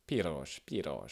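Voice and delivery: piros with a trilled tip-of-tongue R; slow Hungarian rolled R. Shown as a demonstration. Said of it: "le R roulé".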